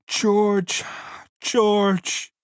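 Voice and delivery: high, rising calls for George; Lenny crying out for George. A narrator's reading. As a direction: call out desperately. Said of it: "And he cried".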